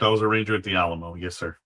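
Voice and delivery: ordinary talk, nothing marked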